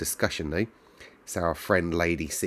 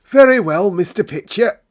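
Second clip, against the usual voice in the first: much higher and louder, prim and proper; a bossy housekeeper answering sharply; stiff housekeeper; 12 semitones higher, 11 dB louder than usual. A narrator's reading. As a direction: housekeeper to the butler, loud and prim. Mrs Medlock replies stiffly.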